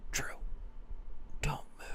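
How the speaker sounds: hushed, urgent whisper; dad whispering to son in tree; whispered warning. Retold or imitated. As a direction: whisper so the deer won't hear. Dad in tree spotting deer.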